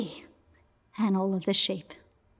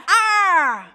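loud, high falling yell; shepherd's battle cry; 8 semitones higher, 8 dB louder than usual. Acted. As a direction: cry out in the thick of a fight. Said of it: "The shepherd cried".

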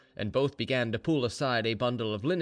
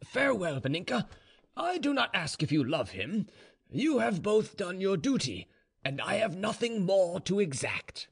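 higher-pitched formal dramatic voice; stern general, solemn; 8 semitones higher than usual. Acted. General takes leave of his daughter, solemn and formal. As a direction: deliver a grave, final farewell.

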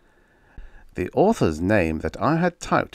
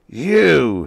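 higher, louder accusing shout; 6 semitones higher, 8 dB louder than usual. Acted. Enraged writer shouting at his typewriter. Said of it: "he shouted".

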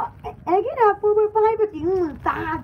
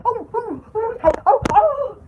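ice-cube shudder; 3 semitones higher than usual. Shown as a demonstration. Showing ice cube down the shirt, shuddering oohs. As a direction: gasp as if ice slid down the back. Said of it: "like that".

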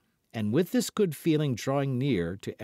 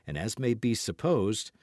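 ordinary delivery, nothing marked

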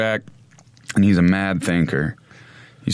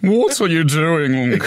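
prissy high voice; 6 semitones higher, much slower than usual. Pretend. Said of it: "Miss Doubtfire voice continued".

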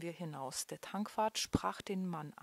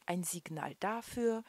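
ordinary delivery

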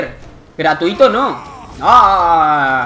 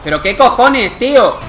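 angry outburst; 4 semitones higher, 5 dB louder than usual. Real, not acted. Raging at the game.

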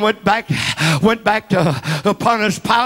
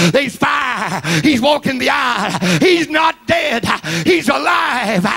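full-force preaching; 6 semitones higher than usual. Acted. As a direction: preach it to the rafters. Preacher building to a climax.

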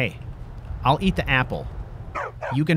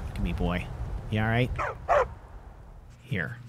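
soothing dog; 4 semitones lower, 8 dB quieter than usual. Real, not acted.

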